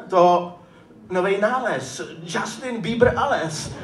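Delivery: ordinary talk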